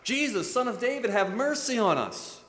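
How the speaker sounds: high pleading call; first cry. Retold or imitated. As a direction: call out to Jesus. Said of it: "they cried out".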